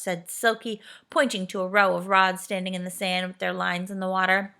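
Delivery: usual speaking voice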